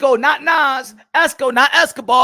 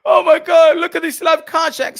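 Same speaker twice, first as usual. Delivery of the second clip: mock outraged whine; mocking complainers; 4 semitones higher, 6 dB louder than usual. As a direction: parody the whiners.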